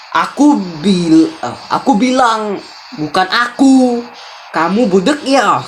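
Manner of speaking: acted anger, drawing out each phrase; angry. Husband denies taking the savings, acted anger. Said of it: "Marah dia".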